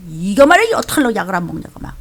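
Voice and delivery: ordinary talk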